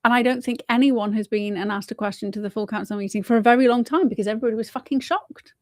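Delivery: ordinary talk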